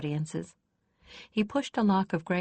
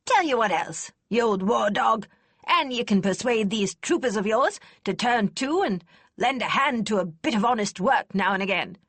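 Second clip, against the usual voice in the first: put-on louder, gruff old lady scoffing kindly; snorting, not unkind; 5 semitones higher, 5 dB louder than usual. A narrator's reading. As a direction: snort at the lad but keep it kind. Kester bargaining with Lord Marshal.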